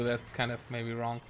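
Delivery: ordinary talk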